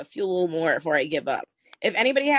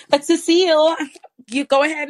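calling out; 9 semitones higher, 7 dB louder than usual. Real, not acted. Calling on a muted attendee.

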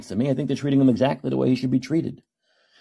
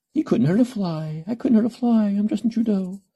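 mock Hannibal Lecter; 10 semitones higher than usual. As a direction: purr it like a sweetly menacing killer. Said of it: "that Hannibal Lecter way of speaking, that very passive aggressive".

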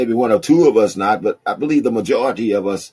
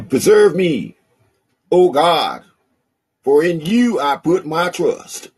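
raised, high proclaiming reading of the verse; solemn proclaiming read; 8 semitones higher, 4 dB louder, slower than usual. Shown as a demonstration. Preacher reading the psalm aloud to the congregation, proclaiming. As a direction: declare the verse up high and loud. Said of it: "Can I use that country accent this morning".

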